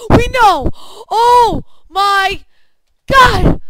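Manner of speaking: high, loud exasperated shout; frustrated yell. Real, not acted. Gamer yelling at the game after falling. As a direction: yell it out in disbelief.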